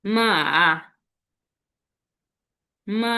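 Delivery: drawn-out "মা" dipping then rising; third tone. Shown as a demonstration. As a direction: sink "মা" and bring it back up. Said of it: "third tone". ("মা" তৃতীয় টোনে, নেমে আবার উঠে)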